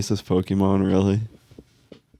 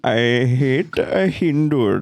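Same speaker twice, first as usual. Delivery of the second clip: higher mock Indian accent, slowing; hammy Indian accent; 5 semitones higher, slower than usual. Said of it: "I'd just do the Indian accent".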